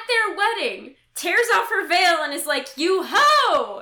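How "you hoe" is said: loud, comic denunciation; mock outraged accusation. Jilting groom to his bride at the altar, mock outraged. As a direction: hurl it as a hammed-up insult.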